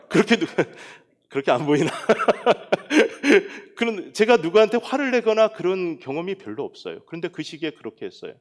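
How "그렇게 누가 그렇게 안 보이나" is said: talking through a laugh; real laughter breaking into the words; amused laughing. Real, not acted. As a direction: chuckle while doubting the audience's reaction. Laughs at own gentle-nature claim.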